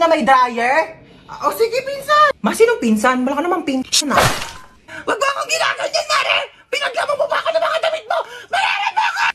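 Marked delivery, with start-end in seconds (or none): 1.2-2.3 sweet fake phone voice. Fake phone call with cousin. 5.0-9.2 furious shrill scolding. Acted. Angry neighbor shrieking at her kumare over the laundry.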